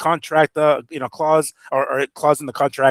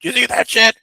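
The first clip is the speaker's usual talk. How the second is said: higher-pitched mock dad bursting in; imitating excited dad; 9 semitones higher, 3 dB louder than usual. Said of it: "he comes into my room barging".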